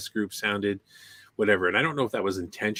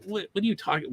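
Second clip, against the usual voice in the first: incredulous; 9 semitones higher, faster than usual. Retold or imitated. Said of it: "I'm like".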